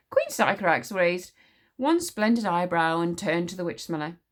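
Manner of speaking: plain reading voice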